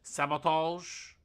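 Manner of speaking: slow Sabotage with a light French lilt; a touch of French on Sabotage; lingering French Sabotage. Shown as a demonstration. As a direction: echo the French Sabotage, slowly. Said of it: "get Sabotage".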